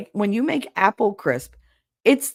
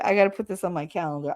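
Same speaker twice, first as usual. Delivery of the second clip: normal talking voice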